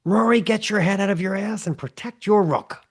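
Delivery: high-pitched mock angry yell; an exasperated dad hollering orders; losing his shit. Chess dad to son Rory, losing his shit. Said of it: "losing his shit during the chess club tournament".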